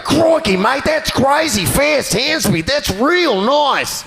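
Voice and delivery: very high, slow Steve Irwin Australian; Australian accent. Retold or imitated. Steve Irwin praising hand speed, Australian accent. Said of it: "he's like".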